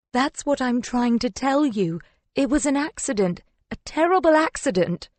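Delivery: high, urgent insistence; pleading. A narrator's reading. Woman insisting to her lover it was an accident, pleading. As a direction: plead innocence, climbing on the last phrase.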